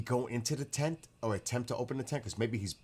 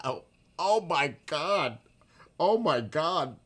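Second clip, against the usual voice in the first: shocked disbelief; 7 semitones higher, 8 dB louder, much slower than usual. Real, not acted. Shocked by a crude chat story.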